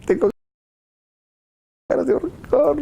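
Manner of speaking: high pinched strangled voice; Joseph holding back anger. Retold or imitated. Joseph straining to hold back rage at his brothers, high strangled voice. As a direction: squeeze out the fury through a choked high voice. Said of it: "como diciendo".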